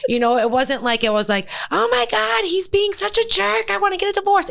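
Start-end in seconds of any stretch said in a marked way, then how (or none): 1.7-4.5 high-pitched, slowed, overwrought outburst; mock hysterical rant. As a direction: play up the panic, voice leaping way up and dragging. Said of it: "It wasn't like that".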